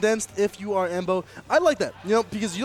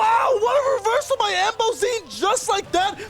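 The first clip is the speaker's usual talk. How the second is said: high, loud play-by-play shout; excited shout; 10 semitones higher, 4 dB louder than usual. Real, not acted. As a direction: scream the reversal call.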